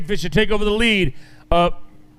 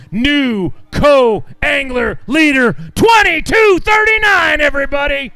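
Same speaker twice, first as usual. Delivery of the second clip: much higher and louder, slow triumphant call; excited shout; 6 semitones higher, 4 dB louder, slower than usual. Real, not acted. Announcer shouts the new leader's weight to the crowd, much higher and louder.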